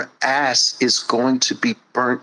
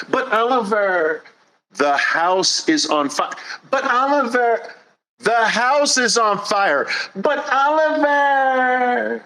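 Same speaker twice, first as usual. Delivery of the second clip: mock whine climbing higher each time; exasperated parody of endless buts; rising whine; 10 semitones higher than usual.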